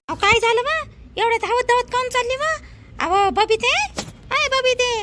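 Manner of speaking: high, loud worried questions; anxious calling out. Acted. Neighbour woman calling out asking where she runs. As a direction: call across to a neighbour in alarm.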